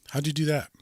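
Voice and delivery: usual speaking voice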